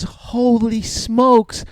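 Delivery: high, drawn-out mock amazement; hammed-up awestruck lady; amazed woman customer. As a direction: marvel slowly in a high woman's voice. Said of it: "She goes".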